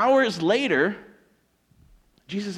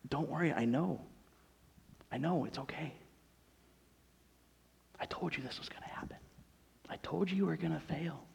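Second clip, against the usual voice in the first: very soft, tender reassurance; hushed, nearly whispered comfort; compassionate; 12 dB quieter than usual. Acted. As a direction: gently reassure a friend who failed. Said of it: "more like a compassion".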